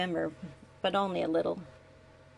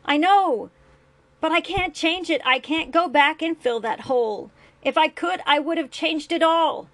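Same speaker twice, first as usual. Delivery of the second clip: loud, higher and pained; guilty shouting; 7 semitones higher, 7 dB louder than usual. A narrator's reading. Father yelling his regret at his son, anguished shout.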